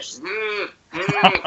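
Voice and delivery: high-pitched, uneasy "mm"; unamused audience hum. Retold or imitated. Comic retelling his audience neighbours' reaction, high doubtful hum. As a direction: imitate a crowd humming instead of laughing. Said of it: "audience that was sitting by me was just like".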